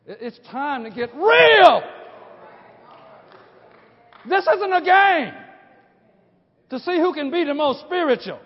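loud, high, shouted warning; fiery preaching. Acted. Preacher warning the congregation that this is war, shouting. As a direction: hammer it home like a war cry.

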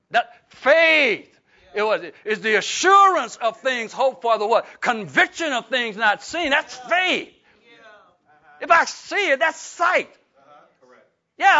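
loud, high preacher's declaration; preaching. Acted. Pastor preaching on faith to church, loud and high. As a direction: proclaim it to the congregation.

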